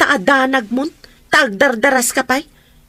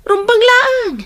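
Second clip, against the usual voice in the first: sharp, much higher, louder snap; angry outburst; 11 semitones higher, 3 dB louder, slower than usual. Acted. Aunt snapping at her niece about the electric bill, angry. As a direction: bite back at her hard.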